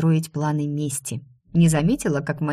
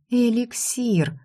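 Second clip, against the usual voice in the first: sudden realization; 6 semitones higher, much slower than usual. A narrator's reading.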